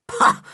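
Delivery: sharp high scornful pah; disgusted pah. A narrator's reading. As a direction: spit out contempt. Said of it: "in disgust".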